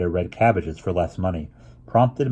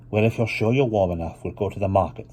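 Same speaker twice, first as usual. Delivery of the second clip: Mama voiced.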